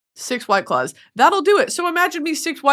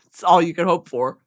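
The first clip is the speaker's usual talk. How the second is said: ordinary delivery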